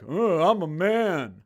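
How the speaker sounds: slow, mocking imitation of a man declaring himself; mock macho man. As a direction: put on a dopey, drawn-out man voice. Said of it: "why you gotta be all like".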